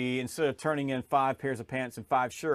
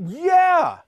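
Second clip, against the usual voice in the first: much higher and louder, drawn-out knowing yeah; exaggerated agreeing yeah; 10 dB louder than usual. Clients recalling checkout scan failure, emphatic knowing yeah. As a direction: mimic clients finally getting it, emphatically. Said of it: "they go".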